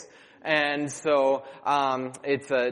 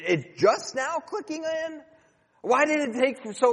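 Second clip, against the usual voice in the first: mocking disbelief; 9 semitones higher than usual. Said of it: "I could have said".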